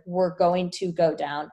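slow, word-by-word "going to go down"; retold freak-out. Passengers on a shaking plane, slow mock freak-out. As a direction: spell out each word of "going to go down" slowly. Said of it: "Everyone's freaking out".

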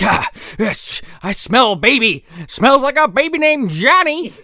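rising to very high, savoring villain voice; creepy monster sniffing. Retold or imitated. Villain chasing the baby through the woods, high creepy relish. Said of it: "He's like".

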